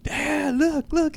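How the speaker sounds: high, mock excited yell; strained high call, no real volume; retold yelling. Calling partner over to watch wrestling, high mock yell. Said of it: "I just kept yelling".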